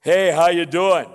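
big, loud, booming greeting. Shown as a demonstration. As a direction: boom it out heartily. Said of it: "because my booming voice".

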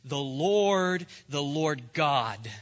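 loud, drawn-out divine announcement; solemn proclamation. Acted. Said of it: "the Lord passed before him and proclaimed".

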